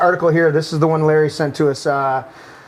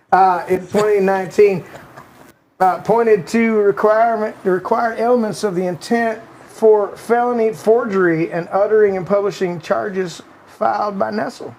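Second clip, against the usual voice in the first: Bill Clinton voice; 3 semitones higher than usual. Retold or imitated. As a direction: read the article as a mild Clinton impression with a Southern accent. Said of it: "in the Clinton voice".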